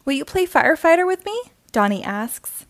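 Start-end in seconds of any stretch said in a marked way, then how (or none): 0.1-1.5 put-on high, fast eager boy; eager kid voice. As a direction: rush it like an excited kid. Eager little brother asks.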